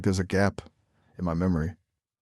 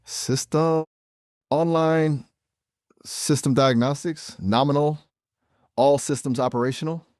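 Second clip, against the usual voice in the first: higher, mechanical system readout; robotic reboot voice; 7 semitones higher than usual. Acted. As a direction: announce it like a booting computer.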